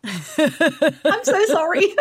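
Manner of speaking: high, drawn-out words through laughter; laughing. Real, not acted. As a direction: crack up while speaking. Laughing over husband's verdict.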